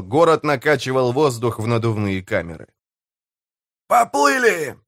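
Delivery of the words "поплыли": high, drawn-up rallying cry; bellowed command. A narrator's reading. Pirate mayor signals the engine room to go, high yell. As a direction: call out the launch in a high voice. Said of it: "гаркнул мэр".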